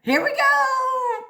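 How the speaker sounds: high, slow, drawn-out rally; excited prompt. Shown as a demonstration. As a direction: draw it out high for the kids. Rousing children to repeat the word.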